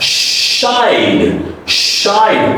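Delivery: 'shied' with a long gliding vowel and voiced D; diphthong plus D. Shown as a demonstration. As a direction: draw out 'shied' as shy-d. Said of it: "there is a diphthong sound".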